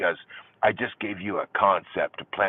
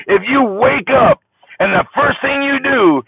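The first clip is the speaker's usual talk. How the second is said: high, loud, slow comic punchline voice; Jeff Foxworthy; 11 semitones higher, 7 dB louder than usual. Retold or imitated. Jeff Foxworthy doing a stand-up bit, loud and slow. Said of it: "I went Jeff Foxworthy on you".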